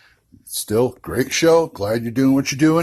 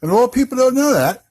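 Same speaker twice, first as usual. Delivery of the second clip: much higher, fast mock British accent; imitating Colin's accent; 13 semitones higher, 4 dB louder, faster than usual. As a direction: ham up the host's British voice, quick and high. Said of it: "you talk like this".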